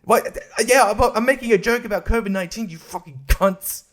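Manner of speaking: high, fast whining rant about YouTube; mock ranting complaint. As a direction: complain bitterly to the helpline. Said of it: "I'm like".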